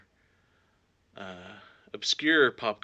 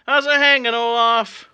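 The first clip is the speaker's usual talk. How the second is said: loud; 11 semitones higher, 9 dB louder than usual. Real, not acted. Greeting sock puppet loudly.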